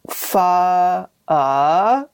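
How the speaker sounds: pho in two slow parts, pho then uh; broken-down pronunciation. Shown as a demonstration. Teacher breaking down the vowel for hosts, slow and separated. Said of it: "it's like an interrogative".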